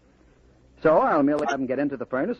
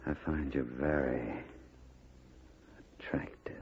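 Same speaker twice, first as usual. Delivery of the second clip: softer, lower, fading into a breathy whisper; intimate whisper; 11 semitones lower, 14 dB quieter than usual. Acted. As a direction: lean in close and whisper it. Duke seducing Lola.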